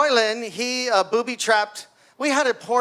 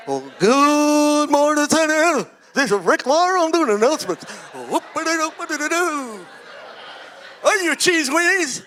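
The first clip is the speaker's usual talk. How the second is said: mock announcer; 5 semitones higher than usual. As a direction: play the goofy school announcer. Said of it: "that was him".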